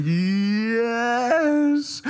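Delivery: long drawn-out yes, climbing high; mock-triumphant slow-motion cry. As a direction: stretch it out as if time slowed. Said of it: "it was like slow motion".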